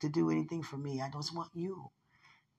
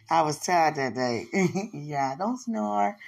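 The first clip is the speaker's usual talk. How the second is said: laughing; 9 dB louder, slower than usual. Real, not acted. Laughing excuse.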